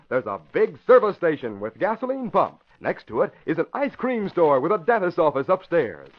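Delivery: usual speaking voice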